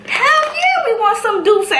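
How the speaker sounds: mock-squeal an eager yes; excited acceptance. Acts out eager joint answer.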